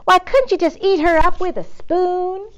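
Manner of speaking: high, loud gushing Southern voice; Houston lady doting, high and loud. Acted. Eugenia continues gushing.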